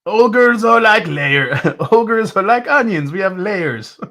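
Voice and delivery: high mock Scottish ogre voice; Shrek impression. Tasting whiskey, quoting Shrek in a put-on voice. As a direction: ham up the ogre line in a bad Scottish accent. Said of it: "I feel like Shrek".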